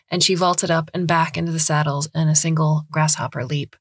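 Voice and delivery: plain reading voice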